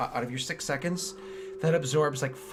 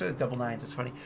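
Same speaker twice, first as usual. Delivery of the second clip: normal talking voice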